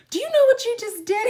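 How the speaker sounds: very high, loud, fast retold outburst; hammed-up high-pitched confrontation; mock outraged yell. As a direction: shriek it in playful accusation.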